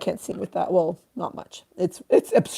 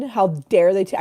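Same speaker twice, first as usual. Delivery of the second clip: ordinary talk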